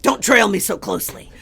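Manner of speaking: loud, exasperated plea to back off; loud pleading. Retold or imitated. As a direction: beg her loudly to keep her distance. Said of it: "I was like".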